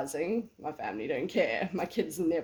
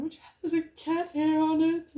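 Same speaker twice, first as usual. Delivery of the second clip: surprised; 11 semitones higher, 8 dB louder than usual. Real, not acted. Spots cat hair on machine.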